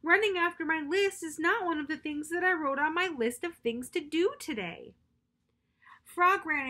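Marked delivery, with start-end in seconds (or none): 0.0-5.0 high, louder whiny complaint; storybook wail. Acted. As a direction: whine it out in frustration. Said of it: "wailed Toad".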